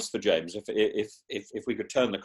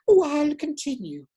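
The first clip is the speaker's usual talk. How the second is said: higher, slow nanny voice; mouthing along to the film. Retold or imitated. Guest dubs Mrs Doubtfire with the sound off, slow and high. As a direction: dub the film in a lilting high voice. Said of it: "switch the sound off".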